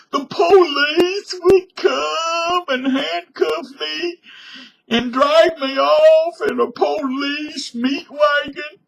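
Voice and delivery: loud, very high hammed-up crying; slow, drawn-out bawling in fake terror; mock wailing. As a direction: wail every word like a terrified crybaby. Mock panic about arrest.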